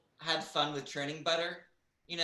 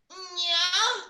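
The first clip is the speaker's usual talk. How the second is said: very high, drawn-out porn-star voice; hammed-up squeal in a porn accent; 8 dB louder than usual. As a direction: put on an exaggerated porn voice. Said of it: "You should try to do that".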